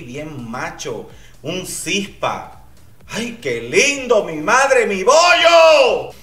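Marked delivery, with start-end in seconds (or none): 3.1-6.1 swooning shout. Real, not acted. Swooning over handsome contestant.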